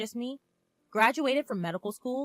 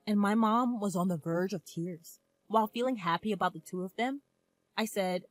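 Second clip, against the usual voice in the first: ordinary delivery, nothing marked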